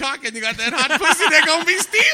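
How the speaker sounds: high, loud laughing shout. Pretend. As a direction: yell it out cracking up. Hyped punchline, shouting and laughing.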